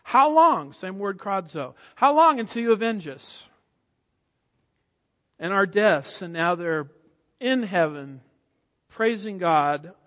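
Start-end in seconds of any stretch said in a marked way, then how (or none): 0.0-0.7 high, loud imitation of a cry; martyrs' yell. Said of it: "remember what they were yelling". 1.9-6.2 raised, loud imitation of a plea; martyrs' yell. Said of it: "remember what they were yelling".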